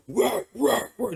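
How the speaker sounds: high mock dog barks; imitated dog barking. Imitating her dog barking at an intruder, mock barking. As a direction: bark like a big dog going off. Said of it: "just barking his head off".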